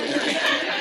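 a quick unvoiced laugh; breathy laugh. Real, not acted. Catching his own slip about crying, he breaks into a short laugh. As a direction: let out a short airy laugh.

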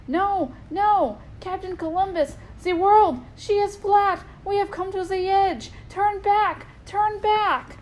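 high, loud panicked scream; screaming lookout. Acted. Lookout screams to the captain to turn back. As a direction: yell the warning from the crow's nest. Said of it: "the lookout began to scream".

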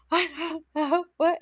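high-pitched imitation of tearful panic; mock crying. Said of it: "crying in this room".

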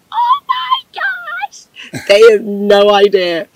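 very high, drawn-out mock squeal; giddy inner squeal. Girl hiding secret romance from friends, giddy squeal. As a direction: squeal slowly, bursting with a secret. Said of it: "In my mind".

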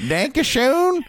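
clueless yokel guess. Retold or imitated. Yokels guessing German for the Nazi, in a high unsure squeak. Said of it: "And they're like".

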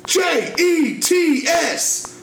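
very high chanted spelling; re-enacted street scream. Telling how he screamed in New York, re-enacted street scream. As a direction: belt each letter out separately, high and drawn out. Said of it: "I just screamed out on the street".

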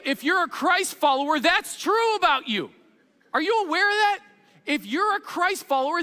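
high-pitched, full-force sermon delivery; impassioned preaching. Real, not acted. Preacher urging the congregation that they're wired to win, impassioned. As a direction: preach it with rising fervour, driving each point home.

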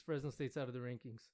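usual speaking voice

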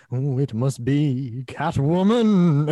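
slow, drawn-out melodramatic deduction; Batman. Retold or imitated. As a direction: stretch every word with hammy gravity. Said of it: "he, like, talks like that".

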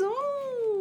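high ooh of pleasure; delighted ooh. Shown as a demonstration. Coach showing how to vocalise delicious food, high delighted ooh. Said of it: "let yourself vocalise how delicious it is".

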